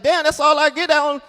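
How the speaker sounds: high, indignant sputter. Retold or imitated. Comedian complaining to his date, indignant. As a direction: sputter it in wounded disbelief. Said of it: "I'm like".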